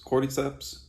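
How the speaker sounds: ordinary talk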